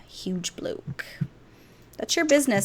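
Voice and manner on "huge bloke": hushed, breathy aside; whispered aside. Pretend. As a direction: whisper it behind your hand.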